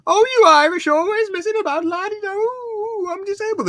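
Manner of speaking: very high hammed-up imitation, loud at the start; high mock rant. Bloke barges in on the scene, high mock rant. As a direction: burst in with a put-on high voice.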